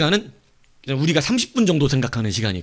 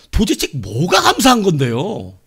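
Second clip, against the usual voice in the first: loud, high, drawn-out complaint; exasperated protest; 4 semitones higher, 6 dB louder, slower than usual. Retold or imitated. Frustrated believer complaining to God, loud exasperated protest. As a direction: demand an answer in mock exasperation. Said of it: "자기 혼난 말이죠".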